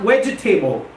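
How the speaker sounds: "vegetable" as vej-uh-TAY-bul; mispronounced vegetable. Shown as a demonstration. Teacher warning students, "vegetable" wrongly as vej-uh-table. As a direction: make "vegetable" end like the word table. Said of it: "It's not vegetable".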